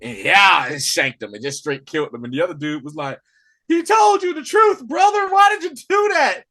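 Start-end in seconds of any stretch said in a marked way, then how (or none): 3.7-6.4 high mock yell at the killer; shouting an accusation in a retold scene. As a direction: yell it high and loud like the angry character. Retelling game scene, character yelling.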